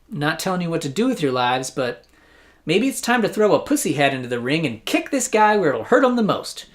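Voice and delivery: normal talking voice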